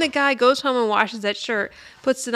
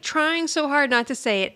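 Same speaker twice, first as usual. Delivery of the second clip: usual speaking voice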